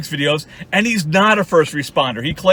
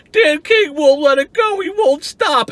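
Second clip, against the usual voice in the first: mocking whiny Walter; 8 semitones higher, 3 dB louder, slower than usual. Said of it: "you're like".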